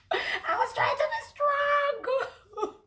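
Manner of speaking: high, laughing and half crying, slowly; laughing through tears. Real, not acted. Stranded traveller admits she nearly cried, laughing and teary. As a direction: laugh it out while fighting back tears. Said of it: "tears were almost coming down from my eyes".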